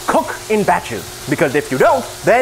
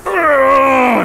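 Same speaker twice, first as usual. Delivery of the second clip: straining grunt; 7 semitones higher, 4 dB louder than usual. Real, not acted. Effort while tossing heavy pan.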